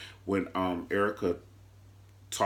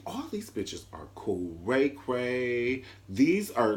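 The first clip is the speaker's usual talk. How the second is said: ordinary talk, nothing marked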